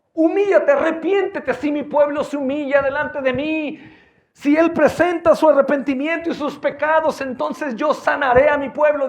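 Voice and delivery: high, loud fiery preaching; preaching at full force. Acted. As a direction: pound the call to repent.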